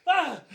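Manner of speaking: high, loud mock scream of fright; jolted awake, laughing as you scream; startled yell. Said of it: "And I'm like".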